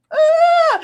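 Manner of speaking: high, loud delighted squeal; happy startled yell; excited shriek. Real, not acted. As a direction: squeal at the surprise gift. Surprised by gifted memberships.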